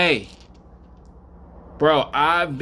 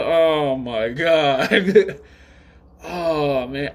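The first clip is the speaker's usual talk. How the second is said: slow amazed laugh; 2 semitones higher, 3 dB louder, much slower than usual. Real, not acted. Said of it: "reacting to video".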